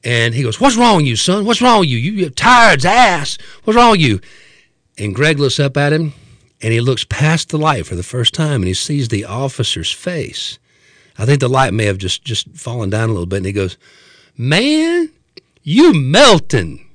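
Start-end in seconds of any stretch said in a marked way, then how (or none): 0.6-4.2 loud, high mock-cop berating; hammed-up officer demanding an answer; exasperated cop. Cop berates strange passenger. 14.4-16.8 high, drawn-out stoned amazement; imitating a dazed friend's slow wonder; awed tripper. Dazed tripper addresses the cop.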